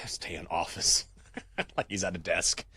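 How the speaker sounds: ordinary delivery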